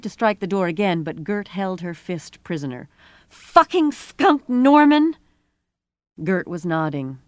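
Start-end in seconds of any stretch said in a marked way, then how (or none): 3.3-5.2 high, loud, drawn-out curse; tearful rage. A narrator's reading. Rosie cursing Norman as Gert holds her fist, enraged. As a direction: cry the curse out furiously. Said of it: "she was weeping with rage".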